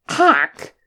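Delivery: high, loud yelp of pain; short choked yelp, pitched sharply up; strangled yelp. A narrator's reading. Dwarf poked in his painful leg, strangled yelp. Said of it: "let out a strangled yelp".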